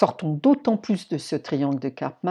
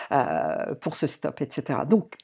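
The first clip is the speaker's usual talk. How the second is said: usual speaking voice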